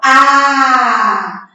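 "A" held long and open; initial syllable sounded. Shown as a demonstration. Teacher answering for the class, "A" held long. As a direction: draw out "A" as one sustained vowel. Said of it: "Qual é a sílaba inicial?".

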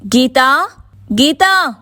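high-pitched calling out of a name; searching call. A narrator's reading. Sirisha searching the house for her sister-in-law, calling out. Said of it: "అంటూ ఇల్లంతా చూసింది".

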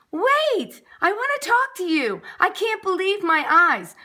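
high, excited baker's cry; amazed calling out. A narrator's reading. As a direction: call after someone in astonishment. Baker calls to the snow dude.

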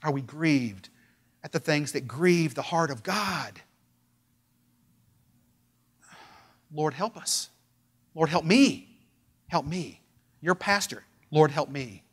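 soft, low plea to God; hushed prayer. Real, not acted. Pastor praying softly before the congregation, hushed. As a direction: quietly beg the Lord for help.

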